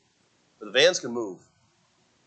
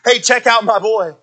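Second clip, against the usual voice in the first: lightly acted proud father, raised pitch and volume; God as proud father; 7 semitones higher, 6 dB louder than usual. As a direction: show off your child, loud and high. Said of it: "he sees all, and he says".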